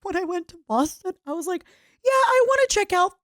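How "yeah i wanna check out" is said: high, loud put-on innocent fib; mock cheerful. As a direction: sell the cover story too brightly. Acted fib to airport officer.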